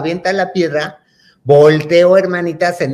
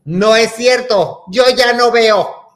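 hammed-up tragic child, drawing words out; melodramatic denial; 6 semitones higher, slower than usual. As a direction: protest like a doomed victim. Child dramatically contradicts reassuring mother.

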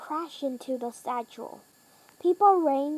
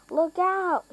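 high, louder warning shout; 5 semitones higher, 4 dB louder than usual. A narrator's reading. Henry warning the others of the plane, loud shout. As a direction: call out a sharp warning. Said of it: "shouted Henry".

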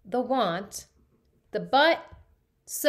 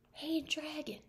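hushed, much softer call; soft whisper; 6 semitones higher, 10 dB quieter than usual. Acted. Elizabeth checks whether the dragon sleeps, very soft. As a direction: test if he sleeps, barely audible. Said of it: "Elizabeth whispered very softly".

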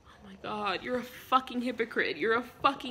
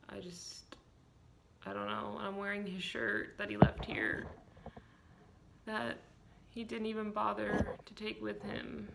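quiet; 10 dB quieter than usual. Acted. Drunk sad whispered self-pity.

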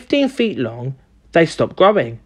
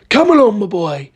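crying out; 4 semitones higher than usual. A narrator's reading. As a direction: call out eagerly, then drop off. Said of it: "cried Mister Killycranky".

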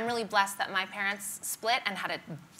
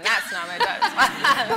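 laughing correction; 4 semitones higher, 8 dB louder than usual. Real, not acted.

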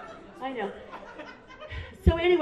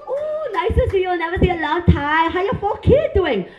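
high, loud Vietnamese nail lady; Vietnamese accent; 7 semitones higher, 7 dB louder than usual. Acted. Nail lady as imagined gynecologist greeting patient, high Vietnamese accent. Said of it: "I love their accent".

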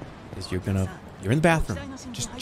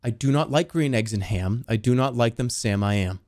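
much lower, level-pitched storybook reading; subdued low reading; 4 semitones lower than usual. Pretend. Rereading Green Eggs and Ham, low and deadpan.

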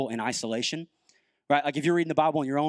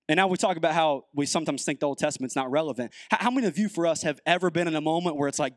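ordinary delivery

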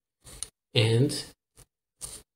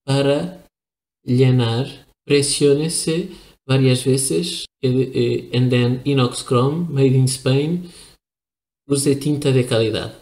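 reading the label in native Spanish; 4 dB louder than usual. Real, not acted. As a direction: read the Spanish ink-converter instructions as a native speaker would. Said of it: "Sorry for my Spanish accent".

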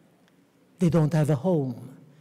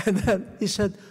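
usual speaking voice

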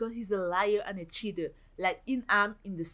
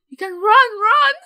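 high, loud cheering-on yell; loud, frantic urging; urgent shout; 11 semitones higher, 14 dB louder than usual. Real, not acted. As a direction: urge the player on at full volume. Urging her Sim to run.

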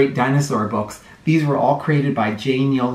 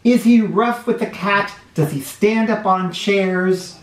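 put-on lively storybook read-aloud for little kids; sing-song picture book reading; reading to children; 2 semitones higher, slower than usual. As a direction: read it like story time on the rug.

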